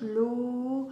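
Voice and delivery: "l'eau" slowly drawn out for show; over-articulate "l'eau" so the child can copy; mouthed syllable demonstration. Shown as a demonstration. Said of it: "Regarde ma bouche". (« l'eau » sur-articulé, sons allongés)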